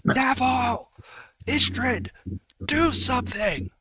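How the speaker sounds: high, loud cries for help; frantic acted yelling; desperate calling out. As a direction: shout to your friends as you're dragged off. Character calls out while dragged away.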